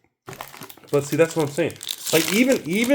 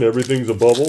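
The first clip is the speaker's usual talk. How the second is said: ordinary talk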